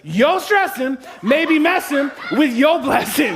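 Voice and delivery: drawn-out preacher's hook, shouted; preached shout. Shown as a demonstration. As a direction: deliver the hook as a slow, high sermon shout. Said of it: "I'm gonna have to offer it to him like this".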